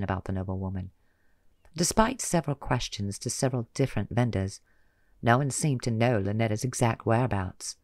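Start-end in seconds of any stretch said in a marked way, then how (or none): none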